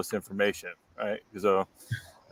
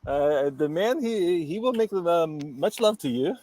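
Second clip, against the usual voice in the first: put-on higher, slow Dutch accent; 6 semitones higher, slower than usual. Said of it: "in your Dutch accent".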